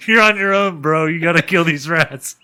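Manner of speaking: laughing high, slow taunt; jeering at the scared dog through laughter; laughing mock taunt. Friend mocks the dog left in the dumpster, laughing.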